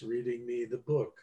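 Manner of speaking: slow talker demo. Shown as a demonstration. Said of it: "this person who talks like".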